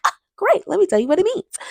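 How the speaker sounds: mock-chirpy teacher voice; eager know-it-all. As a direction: show off cheerfully. Mimicking her own eager reply.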